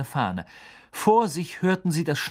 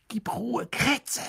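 high, tense hiss at the rat; 11 semitones higher, slower than usual. Acted. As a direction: Ron hushing his rat, high and hissed. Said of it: "zischte Ron".

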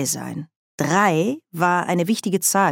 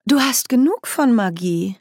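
sweetly raised, falsely kind question; mock-friendly witch queen; false friendliness; 6 semitones higher, 3 dB louder than usual. A narrator's reading. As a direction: sound kind while threatening. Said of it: "mit falscher Freundlichkeit".

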